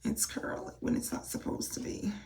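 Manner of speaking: hushed whispered complaint. Real, not acted. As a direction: whisper it, dismayed. Complaining about curl.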